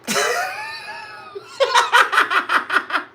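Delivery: very high screaming cackle; loud screaming laughter; shrieking laugh. Real, not acted. Big laugh shout.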